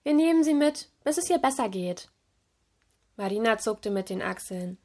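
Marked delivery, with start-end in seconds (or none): 0.0-2.1 resolute, pitched-up announcement; decisive. A narrator's reading. Mermaid deciding to take the turtle along, decisive. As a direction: say it with quick resolve, higher. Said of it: "Kurz entschlossen sagte sie".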